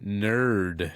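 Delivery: "nerd" drawn out slowly after a pause; demonstrated pronunciation. Shown as a demonstration. Co-host correcting the host's pronunciation, slow deliberate "nerd". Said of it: "Please demonstrate".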